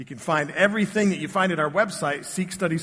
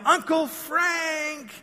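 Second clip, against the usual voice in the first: put-on excited kids' yell; 11 semitones higher, much slower than usual. Kids yell his name in unison.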